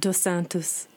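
Dos Santos in Brazilian Portuguese, ending in "-toos"; husband's Brazilian accent. Shown as a demonstration. Showing how her Brazilian husband says it, Brazilian accent. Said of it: "how would your husband say it?".

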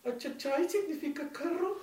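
very high, slow, accented imitation while laughing; mock classmate. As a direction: mimic her earnest question. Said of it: "esta man dice". (imitación muy aguda y lenta con acento)

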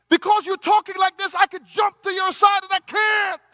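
high, loud, shouting the plea; preaching at full force. Acted. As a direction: shout the love and the refusal to the hall.

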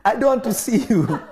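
loud, laughing mock fury; mock angry laboring woman. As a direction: shout it through a laugh. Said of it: "They will look at you and say".

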